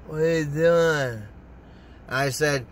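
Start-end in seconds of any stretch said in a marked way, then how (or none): 0.1-1.2 slow, drawn-out put-on voice; lightly mocking slow drawl; imitated girlfriend. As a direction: stretch it out, mimicking her. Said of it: "And she's like this".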